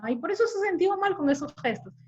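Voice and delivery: high-pitched belittling question; mock the tactless psychologist's incredulity; dismissive disbelief. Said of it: "Así en ese tono".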